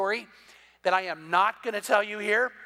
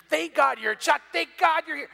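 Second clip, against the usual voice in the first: high, loud joking thanks; joking exclamation; 7 semitones higher, 4 dB louder than usual. Retold or imitated. Golfer jokingly thanks the pastor for vouching his shot. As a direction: ham up the thanks for the laugh.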